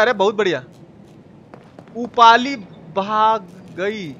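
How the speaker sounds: ordinary delivery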